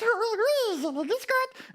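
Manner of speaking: high little puppet voice; frightened puppet. Acted. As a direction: answer as a timid, trembling puppet. Trembling puppet answers Philippe.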